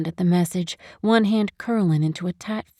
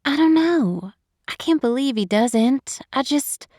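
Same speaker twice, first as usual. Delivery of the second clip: higher, distressed and at a loss; upset; 6 semitones higher than usual. Acted. Woman on phone over friend's heartbreak, higher and distressed. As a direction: struggle to accept it, breaking off.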